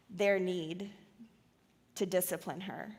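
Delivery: ordinary talk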